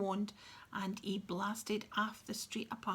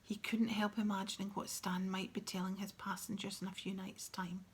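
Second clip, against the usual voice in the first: ordinary delivery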